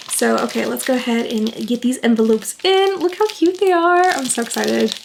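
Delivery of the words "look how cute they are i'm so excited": high-pitched cooing excitement; real, high delighted gush; excited. Real, not acted. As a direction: coo over something adorable.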